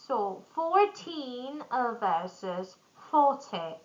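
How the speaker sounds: put-on "fourteen" stressed on TEEN, "forty" on FOR; slow, set the two numbers apart; contrast stress. Shown as a demonstration. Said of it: "tin numbers stress at the end".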